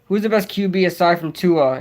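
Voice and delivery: ordinary delivery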